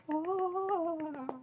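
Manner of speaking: long, high squeal of anticipation; excited squeal. Real, not acted. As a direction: squeal like a thrilled fan. Fan squeals over coming episode.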